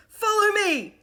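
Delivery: high, loud rallying call; boy leader calling the children to follow; cried out. A narrator's reading. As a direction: lead them away at a run. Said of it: "he cried".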